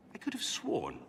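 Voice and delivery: breathy whisper to himself; whispered aside. Acted. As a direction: mutter it under your breath, half to yourself. Muttering to himself among books.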